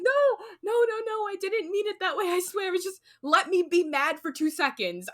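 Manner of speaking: high-pitched mock panic; re-enacted flustered protest, high and easing down; retold frantic backtracking. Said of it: "I got so scared".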